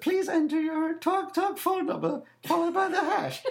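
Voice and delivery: chirpy recorded rejection, mocked; automated phone voice. Caller mocking the voice rejecting his number, high and prim.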